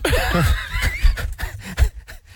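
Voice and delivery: breathy laugh. Real, not acted. Laughing at the robot.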